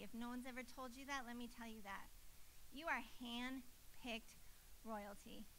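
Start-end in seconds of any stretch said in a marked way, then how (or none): 2.7-5.4 softer, slow and intimate, "royalty" almost breathed; tender reassurance. Real, not acted. Preacher assuring the congregation of their worth, tender and quiet. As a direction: lean in and tell them gently who they are. Preacher speaking personally to listeners.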